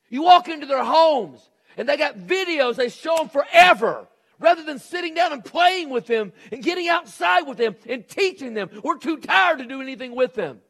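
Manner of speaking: loud, high preaching, driving every line; fiery preaching. Acted. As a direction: preach it hard to the congregation. Preacher at full force.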